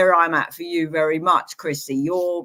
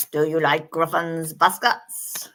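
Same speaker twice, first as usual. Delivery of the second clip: slow mock New Zealand accent; playful, drawn-out New Zealand accent. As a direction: ask it teasingly in a put-on New Zealand accent. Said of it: "That's my New Zealand accent".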